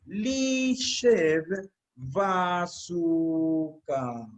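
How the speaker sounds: "lishev basuka" very slowly, each syllable stretched; dictado lento. Shown as a demonstration. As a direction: dictate "lishev ... basuka" with a long pause between the words. Dicta la frase para escribirla. ("lishev basuka" dictado muy lento, sílaba por sílaba)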